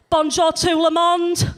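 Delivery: put-on loud, slow French in a broad Yorkshire accent; broad northern French. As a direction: swan in, French with a Leeds accent.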